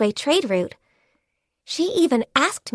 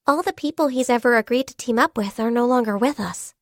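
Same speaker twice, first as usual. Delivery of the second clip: normal talking voice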